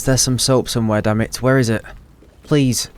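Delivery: ordinary talk, nothing marked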